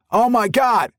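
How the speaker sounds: high, loud cry of alarm. A narrator's reading. Secret Service man crying out at the runaway trolley, high alarmed cry. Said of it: "Craig cried".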